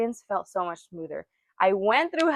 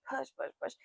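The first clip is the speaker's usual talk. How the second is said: soft, spent chant to herself; tired mock push chant, much softer; exhausted murmur; 3 semitones higher, 14 dB quieter than usual. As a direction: barely get the words out, worn down. Retelling herself pushing, exhausted.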